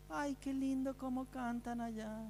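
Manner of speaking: a prisoner sighs at the lovely singing; admiring prisoner. Pretend. Prisoner listens to the singing from his cell, soft admiring sigh.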